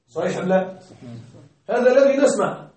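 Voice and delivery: ordinary delivery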